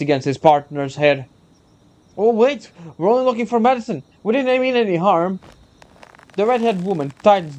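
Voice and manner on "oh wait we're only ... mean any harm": high, panicked plea; frightened pleading. Acted. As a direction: beg for mercy, scared. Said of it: "the frightened man".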